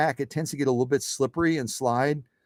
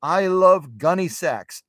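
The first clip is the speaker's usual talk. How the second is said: slow, higher-pitched imitation of a fan; eager viewer; 4 semitones higher, 3 dB louder, much slower than usual. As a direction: draw it out with acted enthusiasm. Acting a viewer, first take before escalation.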